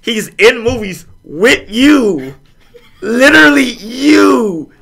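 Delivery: high, loud, slow, incredulous insistence; exasperated actors. Retold or imitated. Actors telling Gwyneth about Samuel L. Jackson, high loud disbelief. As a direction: spell it out to her in disbelief. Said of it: "And they're like".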